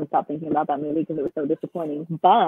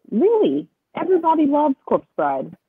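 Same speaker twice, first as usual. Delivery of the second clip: high-pitched, drawn-out retold disbelief; incredulous; 10 semitones higher, 4 dB louder, slower than usual. Friend retells hearing the film was removed, incredulous. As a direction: replay the protest, pitch jumping up.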